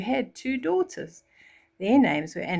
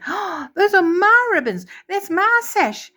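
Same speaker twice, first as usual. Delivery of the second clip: much higher, much louder shriek; shrieking stepsister; 11 semitones higher, 7 dB louder than usual. Acted. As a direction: shriek in outrage. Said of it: "The stepsisters shrieked".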